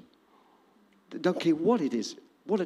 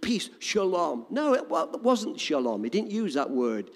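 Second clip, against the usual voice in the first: ordinary talk, nothing marked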